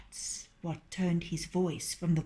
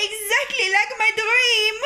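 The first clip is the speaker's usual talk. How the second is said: very high, loud shriek; screaming hen; 14 semitones higher, 7 dB louder than usual. A narrator's reading. Hen sees the fox take the rooster, shrieking. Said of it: "screamed Partlet".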